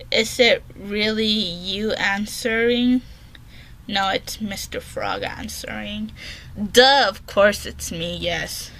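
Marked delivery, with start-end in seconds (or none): 3.9-8.7 whispered deadpan sarcasm; mock the question under your breath; sarcastic whisper. Real, not acted. Girl teasing the app about who answers, whispered sarcasm.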